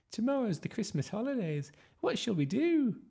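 put-on high, piping child's voice; eager boy Eugene. A narrator's reading. Eugene to friends about the holidays, high eagerness. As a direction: squeak it out with anticipation.

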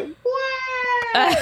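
very high shouted call back; mock yell from the forest; distant reply shout. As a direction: answer a call from far off. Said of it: "Desde el bosque salió de que".

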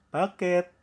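high, loud delivery shout; courier's call. Acted. Joking courier at grandma's door, calling out.

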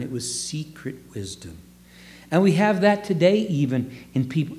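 ordinary talk, nothing marked

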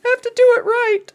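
high squeaky elf voice; a whiny little elf answering back; christmas elf. Pretend. Elf continues.

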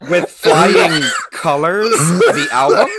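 laughing loud question; laughing shout. Real, not acted.

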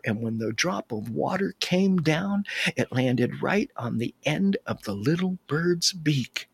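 ordinary delivery

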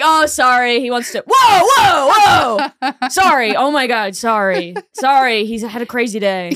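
loud, apologetic, struggling with a pulling dog; shouting whoa while being dragged along; flustered pet owner. Pretend. Acted owner of unruly invisible dog.

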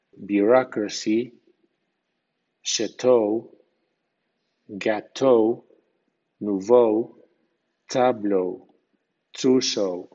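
chateau, gâteau, nouveau ending in a clear "ou"; French-style English words. Shown as a demonstration. Teacher demonstrates French-origin words, careful clear pronunciation. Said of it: "E A U ... suena ou".

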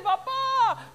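put-on high child's call; a small child crying out papá. Child calling papá in the night, high.

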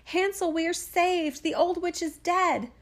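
high, drawn-out triumphant cry; crying out joyfully. A narrator's reading. Gretel freeing Hansel from the stable, joyful high cry. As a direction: call out the good news high and slowly.

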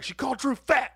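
high, loud retort; indignant protest. Retold or imitated. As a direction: re-enact a kid justifying himself hotly. Indignant kid justifies himself to dad.

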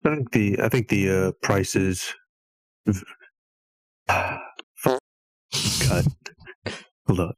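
dry morning mouth; just woke up. Real, not acted. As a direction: talk with a sleepy, dry mouth. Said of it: "I just woke up, so m-, my mouth is kinda, like, weird".

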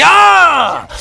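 sudden high, loud cry of alarm; high-pitched yell as the tension snaps back; mock scream. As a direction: jolt the audience with a yelp.

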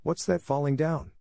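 lightly voiced insistent little one; puzzled insistence. A narrator's reading. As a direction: press the question with childlike curiosity. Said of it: "The puzzled child insisted".

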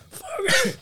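high exclaim. Real, not acted. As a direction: toss it off up high. Same words said twice differently.

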